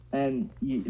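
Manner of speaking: normal talking voice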